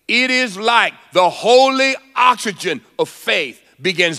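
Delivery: high-pitched, driving sermon delivery; impassioned preaching. Acted. Preacher urging the congregation to guard their words, impassioned. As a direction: preach it urgently, pitch lifted throughout.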